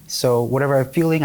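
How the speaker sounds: ordinary delivery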